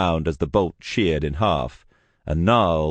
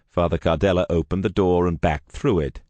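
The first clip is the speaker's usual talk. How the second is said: ordinary delivery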